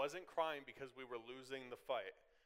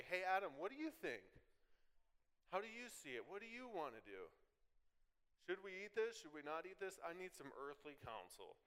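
higher, fretful questions to Adam; mock Eve asking Adam; 5 semitones higher, 3 dB quieter than usual. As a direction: ask him, pitched up and trailing softer. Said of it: "we say".

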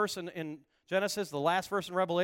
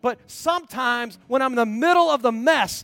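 higher and louder preaching over the music; full-force preaching; 4 semitones higher, 5 dB louder than usual. Acted. Preacher urging the congregation to know God, fervent. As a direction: lift the room with rising fervour.

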